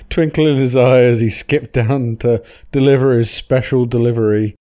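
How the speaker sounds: usual speaking voice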